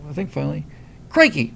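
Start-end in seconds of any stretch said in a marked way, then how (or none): 1.1-1.5 crikey in a mock Australian accent, higher and louder; a cheerful Australian crikey. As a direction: toss off crikey like an Aussie. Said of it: "when an Australian says crikey".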